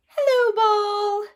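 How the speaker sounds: high, sing-song hello to the ball; silly greeting. Acted.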